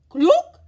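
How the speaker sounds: high, loud hen cluck. A narrator's reading. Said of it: "said the hens".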